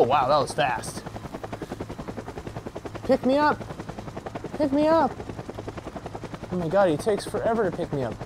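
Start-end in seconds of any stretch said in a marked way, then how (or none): 3.0-5.1 small, very high plea without extra volume; high-pitched calling. Pretend. Stranded skier calling the pilot back, high pleading. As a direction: call out high, begging for a lift.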